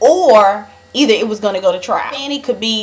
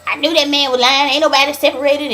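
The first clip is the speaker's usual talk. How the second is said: higher, mock indignant Bunny going off; imitating Bunny; 5 semitones higher than usual. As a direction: voice Bunny's told-you-so rant. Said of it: "Bunny was just talking about".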